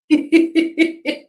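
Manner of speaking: high laugh at running out of time; bright, high laugh; real burst of laughter. Real, not acted. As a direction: laugh it off, caught out.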